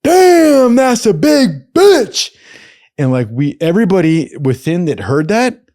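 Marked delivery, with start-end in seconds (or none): 0.0-2.3 a much higher, louder yell across the street; distant scream. Retold or imitated. Stranger in the crowd screams at the tall woman, a much higher, louder yell. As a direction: scream it out so the whole crowd turns. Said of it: "we hear someone scream".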